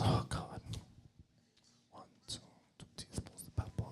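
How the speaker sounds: breathless whispered muttering; sulky whispered count. Retold or imitated. Wife counts under her breath.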